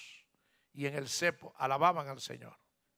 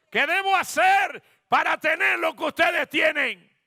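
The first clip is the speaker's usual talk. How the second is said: loud, high preacher's cry; full-force, high shouted question; shouted plea; 12 semitones higher, 11 dB louder than usual. Acted. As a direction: belt the question out to the whole church. Same question repeated as a shout.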